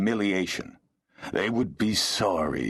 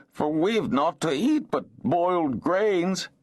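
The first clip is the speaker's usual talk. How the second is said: higher, whining complaint; plaintive whine; 11 semitones higher than usual. Acted. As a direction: whine it like a beggar. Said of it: "His voice changed to a plaintive whine".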